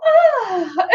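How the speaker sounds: high ah sliding into a laugh; put-on delighted laugh; fake laugh. Shown as a demonstration. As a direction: gasp then giggle for the camera. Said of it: "is that I go".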